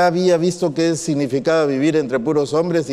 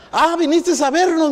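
surprised; 11 semitones higher than usual. Retold or imitated. Sister questioning him at the door.

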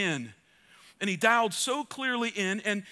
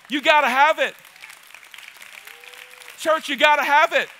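much louder and higher; preaching shout; 5 semitones higher, 8 dB louder than usual. Acted. Preacher exhorting the congregation, full-force shout. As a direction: belt it at the church.

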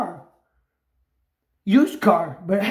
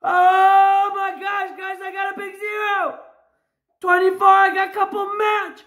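real jubilant yelling, vowels stretched long; excited shouting; 3 semitones higher than usual. Real, not acted. Scratcher finds a big zero on the ticket, excited shouting.

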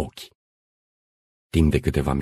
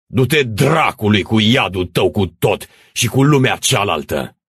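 loud, raised-pitch curse; angry retort; 6 semitones higher, 4 dB louder than usual. Acted. Rebels to the priest in their way, angry retort. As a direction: throw the curse back at him.

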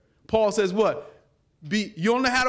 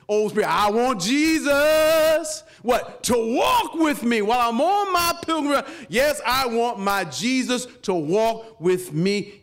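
preaching; 2 semitones higher, 3 dB louder than usual. Acted. Preaching at full force.

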